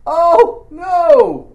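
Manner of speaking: loud, high, drawn-out alarm; panicked cry. Acted. Servant seeing the army round the city, crying out in panic. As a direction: wail it out in hammed-up dismay. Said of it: "And his response".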